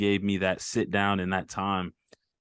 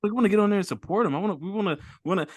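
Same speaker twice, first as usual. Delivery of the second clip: excited; 9 semitones higher, faster than usual. Retold or imitated. Retelling eager guests wanting in.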